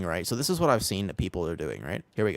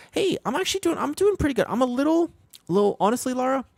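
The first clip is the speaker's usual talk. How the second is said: much higher, squeaky put-on voice; mock falsetto; 5 dB louder than usual. Streamer greets chat in a silly higher falsetto. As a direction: chirp it in a silly high falsetto.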